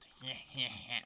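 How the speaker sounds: short breathy laugh; a sudden laugh at himself; burst of laughter. Real, not acted. Laughing at deforested world.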